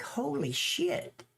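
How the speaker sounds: astonished slow exclamation; retold shock. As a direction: stretch it out, stunned. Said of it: "I was like".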